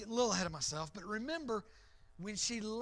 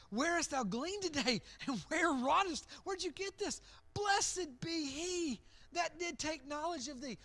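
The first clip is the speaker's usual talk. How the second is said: excited Naomi; 6 semitones higher, 4 dB louder than usual. Acted. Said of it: "She says".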